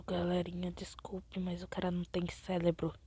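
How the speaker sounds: whispered aside, teasing; confiding whisper. Real, not acted. Gamer whispering to viewers about a dumb teammate, mocking. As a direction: lean in and whisper the insult.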